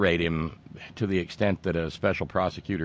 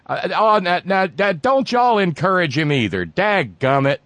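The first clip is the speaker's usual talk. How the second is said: higher hammed-up country scolding; mock folksy scold; 11 semitones higher, 6 dB louder than usual. Host scolding the chat room in a folksy voice. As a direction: wag a finger like a folksy grandpa.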